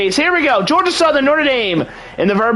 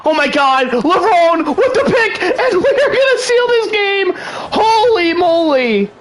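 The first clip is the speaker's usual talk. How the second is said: much higher, laughing and near tears with joy; overjoyed; 7 semitones higher than usual. Real, not acted. Gamer celebrating the game-winning interception, laughing near tears. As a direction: crack up with sheer disbelief and joy.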